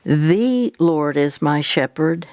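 THE stretched out, the phrase slow; stress on THE. Shown as a demonstration. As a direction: lean slowly on the opening word "The". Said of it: "you emphasize a different word".

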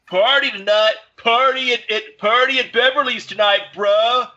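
high, hammed-up teen bro voice; bro text voice. Friend texting the son about Beverly's party, mock bro voice. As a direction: read it like a dumb high school kid hyping a party.

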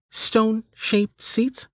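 high, slow, measured question; menu system voice, querying. Acted. Menu offering Delta an option, high slow questioning voice. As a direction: read it out slowly as a high-pitched query.

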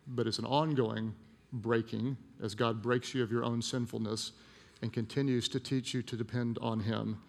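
normal talking voice

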